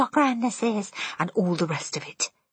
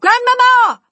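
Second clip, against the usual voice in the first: much higher, loud yell through the door; shouting loudly; 13 dB louder, slower than usual. A narrator's reading. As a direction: call out as loud as you can. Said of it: "I shouted as loudly as I possibly could".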